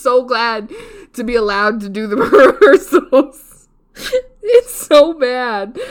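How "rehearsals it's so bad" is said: laugh-crying. Real, not acted. Still laughing about talent show.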